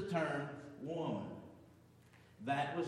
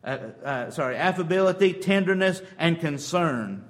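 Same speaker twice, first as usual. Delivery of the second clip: fiery preaching; 11 dB louder than usual. Acted. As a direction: preach it out to the congregation. Preaching at full force.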